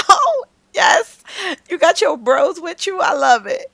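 high, loud hammed-up delight; excited cheer. Cheering on the group after hearing their lyric, excited. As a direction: whoop it out like a thrilled fan.

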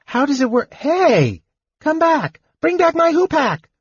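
high, calling after the thieves; cried out. Acted. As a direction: yell after his staff as it speeds away. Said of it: "he cried".

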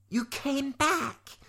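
high, drawn-out cry without volume; mock shout. A narrator's reading. Lavender calling to returning Eliza, high drawn-out mock shout. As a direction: call it out high and slow. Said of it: "Lavender shouted to Eliza".

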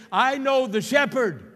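high, loud pulpit cry; preacher's loud declaration. Real, not acted. Preacher giving the church words to say, loudly declaring.

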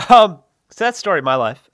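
ordinary talk

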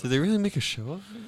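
high, fast, disbelieving; surprised question. Real, not acted. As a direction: ask it again in disbelief. Repeats question unheard.

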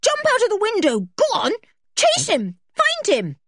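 high, loud, urgent orders; frantic, angry urging; furious commands. A narrator's reading. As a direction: shout rapid commands at the dog. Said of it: "cried George furiously".

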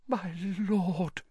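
high, soft, quavering whisper; hushed, high servant voice; awed whisper. Acted. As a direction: breathe it in awe to your master. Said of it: "he whispered".

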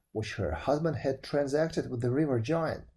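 usual speaking voice

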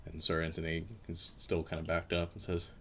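ordinary talk

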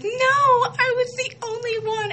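squeaky high excited fangirl voice; high pitched giddy boast. Retold or imitated. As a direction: squeal it like a proud fan.

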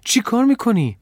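high, loud panicked question; frightened outcry. A narrator's reading. Boy to the girl entering danger, alarmed cry. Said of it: "از ترس خشکم زد".

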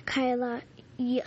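ordinary delivery

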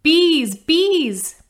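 high, loud alarmed cry of bees; frightened shout. Acted. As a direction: yell the warning in fright. Frightened child shouting warning.

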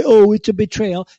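high, whiny mock complaint; mock whine. Mocking critics who cry betrayal, high whiny mock voice. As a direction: parody a crybaby moaning betrayal. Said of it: "that wanna scream and cry".

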